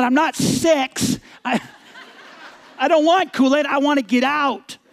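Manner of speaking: loud, high-pitched exasperated retort; indignant. Retold or imitated. Driver refusing the offer of Kool-Aid, indignant. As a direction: snap back in mock outrage. Retold quarrel in parking lot.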